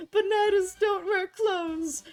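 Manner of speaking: high, loud, drawn-out scream. A narrator's reading. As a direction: wail it slowly in horrified disbelief. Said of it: "and screamed some more".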